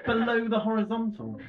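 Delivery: pompous pen scolding the user; huffy pen lecturing the man holding it; talking pen. Pretend. A pen scolding its owner, with mock indignation. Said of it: "and, and say".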